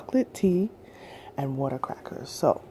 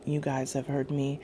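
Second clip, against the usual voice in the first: ordinary talk, nothing marked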